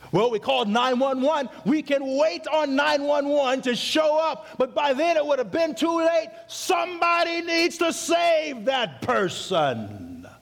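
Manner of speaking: urgent pulpit appeal; rising preaching. Acted. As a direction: build the call to save like a revival preacher. Preacher building to a cry.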